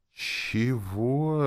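high, drawn-out bewilderment; flustered exclamation. A narrator's reading. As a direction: blurt it in disbelief. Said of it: "растерялся я и воскликнул".

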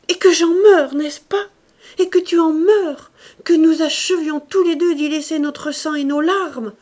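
high, loud bitter accusation; bitter outburst. A narrator's reading. Wife lashing out at the painter's art, bitter. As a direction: throw it back at him.

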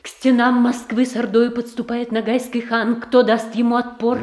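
high, loud, urgent warning; alarmed outcry. Acted. Sister warns her brother Boris of the khan at Moscow's walls, alarmed outcry. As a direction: cry out that the khan is at the gates.

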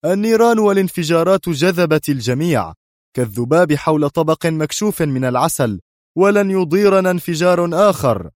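high, forceful, relentless declaration; stern. A narrator's reading. Boss coldly explains the coming explosion, stern and forceful. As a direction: press on with severe authority. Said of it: "تابع الصوت بنفس الصرامة".

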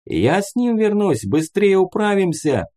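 high-pitched call after someone; shouted promise. A narrator's reading. Fighter running after the departing vehicle, shouting he'll go along. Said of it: "прокричал Муха".

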